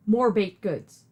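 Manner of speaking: normal talking voice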